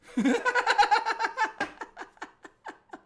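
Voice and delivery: long rising burst of high laughter. Real, not acted. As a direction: crack up high at the shirt. Laughs showing his shirt.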